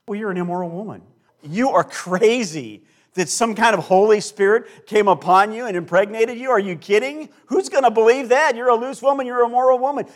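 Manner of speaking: loud, high mocking accusation; voicing the scornful townspeople, loud and high; scornful accusers. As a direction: hurl the insults at her like a crowd. Townspeople scorning Mary, acted out.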